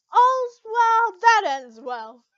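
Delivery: slow, loud, high proclamation; gleeful. Acted. Natsu rejoicing after making up, loud and slow. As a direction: savor every word loudly.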